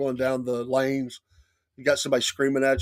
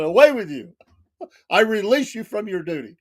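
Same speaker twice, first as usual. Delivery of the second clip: mock royal dismissal; 6 semitones higher, 3 dB louder than usual. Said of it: "he got mad at that".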